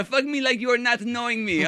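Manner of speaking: high, loud put-on Spanish-accented broken English; man imitating a Mexican lover's accent, high and loud; mock Mexican accent. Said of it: "in my ear with her accent".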